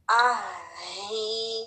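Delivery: आही drawn out with a breathy ह; strong ha drill. Shown as a demonstration. Teacher drills a long, breathy आही. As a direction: stretch आही, breathe hard on the ह. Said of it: "पहला वाला हा भी strong है".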